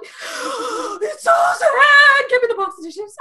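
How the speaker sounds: very high, drawn-out mock wail; high-pitched comic sobbing after a gasp; hammed-up crying. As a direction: bawl it like a baby, playing it up. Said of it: "I cried like a baby".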